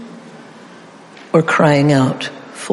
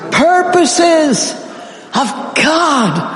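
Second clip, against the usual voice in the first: much higher, voice breaking into a cry; Jesus pleading; 9 semitones higher, much slower than usual. Acted. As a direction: let the plea crack at the end. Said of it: "here's a little bit of my interpretation".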